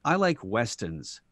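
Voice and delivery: put-on slow, light Boston accent. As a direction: imitate a friend with a hint of Boston. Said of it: "he goes in his Boston accent".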